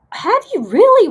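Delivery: high, loud burst of surprise; high-pitched, loud astonished question; surprised. Real, not acted. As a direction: react with amazed disbelief. Amazed by viewer's travel comment.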